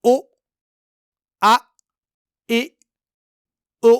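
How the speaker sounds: او، آ، ای، او each cut off with a glottal catch; jerked hamza stops; hamza stopped abruptly. Shown as a demonstration. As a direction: end each of او آ ای او with a sudden stop. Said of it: "اسی طرح یہاں بھی".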